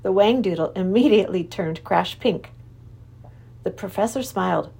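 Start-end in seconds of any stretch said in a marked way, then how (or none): none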